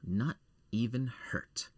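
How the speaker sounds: breathy whispered relief. Acted. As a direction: whisper it to yourself. Whispered aside after landing.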